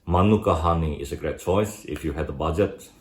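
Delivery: usual speaking voice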